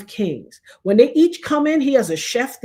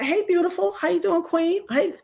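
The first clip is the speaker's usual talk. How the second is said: higher, bright friendly hello; cheerful greeting; 7 semitones higher than usual. Retold or imitated. Greeting strangers warmly, higher cheerful hello. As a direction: greet them like you mean it. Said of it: "I'm like".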